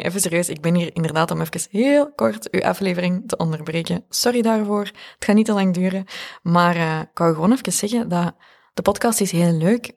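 loud, upbeat promo read. Acted. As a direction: pitch the newsletter energetically. Ad break read to listeners. (luide reclameboodschap)

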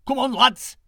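loud, rallying dog call; rally the pack as a bold dog; gruff dog voice. Acted. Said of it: "said Lion".

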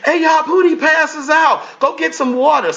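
high yelled call for help; mock alarmed shout. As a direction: shout up high that Pootie passed out. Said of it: "And they're like".

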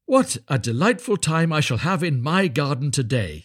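high, happy exclamation; delighted little Hans. A narrator's reading. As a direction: look forward to it gladly. Hans delighted about his garden.

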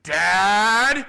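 loud, high accusing yell; accusing shout. Retold or imitated. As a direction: call out your dad, blaming him. Son shouts at father in truck.

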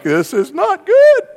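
high, loud, slow comic alarm; panicked squeal, hammed up; mock panic. Man with shovel and machete facing a snake, mock panic.

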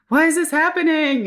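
high, loud panicked question. Retold or imitated. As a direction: cry out in confused alarm. Labor pains, retold panic.

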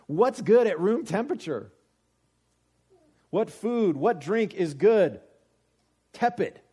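loud, high-pitched rhetorical questions; preacher ranting at high volume; exasperated. Real, not acted. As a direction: pound out each question in loud, incredulous exasperation.

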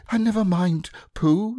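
high, squeaky and impatient; impatient Piglet. A narrator's reading. Piglet cuts Pooh off to get back to business, impatient. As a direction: brush Pooh aside, eager to move on. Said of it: "said Piglet impatiently".

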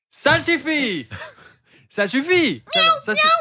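high, ringing "Ça suffit"; mock stern. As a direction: call out ça suffit like a rule. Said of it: "Ça le suffit". (ordre lancé en rigolant)

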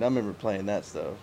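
ordinary talk, nothing marked

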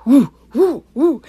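loud, high excited whoops; imitated hyped-up "woo" bursts; fired-up woo. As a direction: whoop like someone all fired up. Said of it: "all fired up, like".

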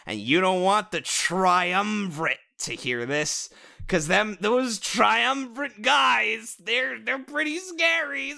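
slow, drawn-out words climbing into a high sing-song; mock ominous warning. Mock warning in villain-name voice.

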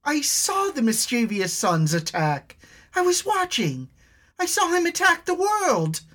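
very high desperate cry; shouting girl. Acted. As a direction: scream out what she saw. Elna shouts at dragon.

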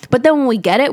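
ordinary talk, nothing marked

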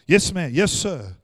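loud, dutiful acknowledgement; short, forceful yes to an order; obedient command center. Acted. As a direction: answer like a soldier taking orders. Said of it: "You know what the command center does?".

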